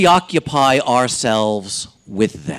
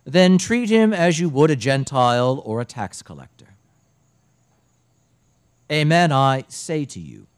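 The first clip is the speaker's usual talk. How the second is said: solemn liturgical reading voice; gospel reading. Acted. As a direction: proclaim the gospel in church.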